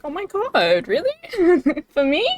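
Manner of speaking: high, giggly disbelief at a gift; playful flattered gushing; touched surprise. Acted. Acts out receiving surprise gift art.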